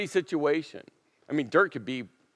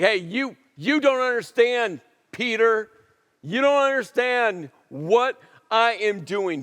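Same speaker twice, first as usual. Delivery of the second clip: raised, insistent voice of Jesus pressing Peter; emphatic Jesus; 7 semitones higher, 4 dB louder than usual. Retold or imitated. Jesus tells Peter he will understand one day, loud and insistent.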